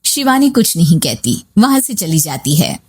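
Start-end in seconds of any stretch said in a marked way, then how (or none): none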